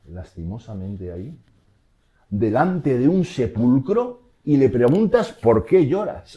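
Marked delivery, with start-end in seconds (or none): 2.3-6.4 high, loud and slow, disbelief at the question; incredulous. Retold or imitated. Preacher asking the crowd, incredulous at the question. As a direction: stretch it out in mock amazement.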